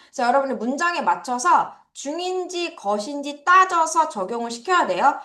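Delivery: ordinary talk, nothing marked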